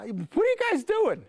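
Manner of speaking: high, bright curious bunny voice; a newcomer rabbit peeking in, asking what's up. Acted. Rabbit arrives and calls to the fenced bunnies, high and curious. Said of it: "he says".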